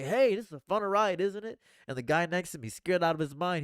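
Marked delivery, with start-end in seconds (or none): 0.0-1.6 higher and louder, thrilled on the ride; cheerful roller coaster call. Retold or imitated. Asking the scared rider beside him if it's fun, excited call. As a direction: call out the fun to your neighbour. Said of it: "and I'm gonna say".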